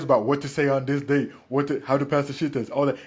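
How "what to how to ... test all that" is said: ordinary talk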